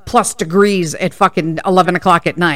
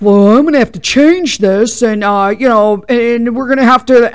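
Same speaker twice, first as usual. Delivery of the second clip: mocking the clerk; 4 semitones higher, 5 dB louder than usual. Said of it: "So he's like".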